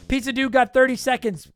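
ordinary talk, nothing marked

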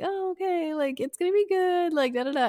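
high, singsong mock reassurance; imitated stalling parent, high and trailing into filler. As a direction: pitch it way up, dragging out the goodbye.

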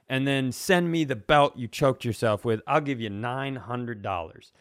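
usual speaking voice

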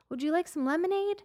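put-on high, sweet child voice; little boys' voice. Little boys offering the walker lemonade, high child voice. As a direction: ask it like a shy kid on a bike. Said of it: "they're like".

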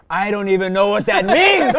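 loud, high exasperated retort; mock angry dad. Dad answering child's big word, mock angry. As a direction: bellow it like a baffled, angry dad.

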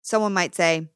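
ordinary talk, nothing marked

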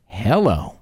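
ordinary talk, nothing marked

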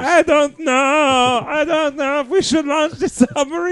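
a worried German sailor, hammed up; cartoon German. Joining the German submarine bit, high, loud cartoon German accent. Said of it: "You both sound like cartoon characters".

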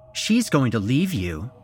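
scornful, high-pitched tirade; angry berating. A narrator's reading. Angry wife berating her husband.

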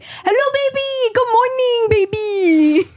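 imitating an older man's high, sing-out greeting; high-pitched, hammed-up cheery hello; gym regular's greeting. Older gym guy greets her whenever he sees her, high mock greeting. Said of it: "Whenever he sees me, he's like".

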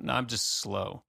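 normal talking voice

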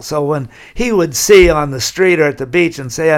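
ordinary talk, nothing marked

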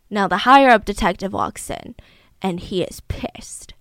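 normal talking voice

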